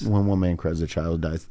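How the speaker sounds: solemn saying. Acted.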